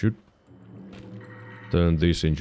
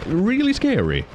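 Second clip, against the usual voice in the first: high emphatic; 13 semitones higher than usual. Real, not acted. Emphatic repeat.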